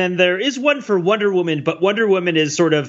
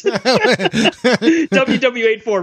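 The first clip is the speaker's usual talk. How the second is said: slow, laughing mock lament; laughing through it; 6 semitones higher, much slower than usual. Real, not acted. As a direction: stretch it out while cracking up. Mourning a bad movie, laughing.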